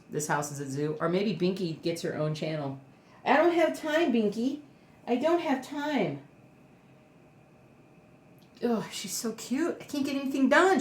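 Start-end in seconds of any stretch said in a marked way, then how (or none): 3.2-6.2 high-pitched mock complaint to a pet; playful whine. Real, not acted. 8.6-10.8 gushing. Real, not acted. Gushing over the dog.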